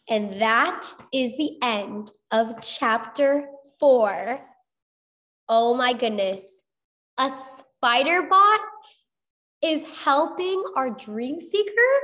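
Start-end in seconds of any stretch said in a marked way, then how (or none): 5.5-11.9 excited. Real, not acted. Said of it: "teacher's excited reaction".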